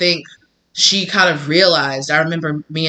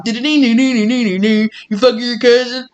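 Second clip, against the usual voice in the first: high banjo-like mimicry in a hillbilly accent; mocking country accent; 7 semitones higher, 4 dB louder than usual. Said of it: "people that had a country accent".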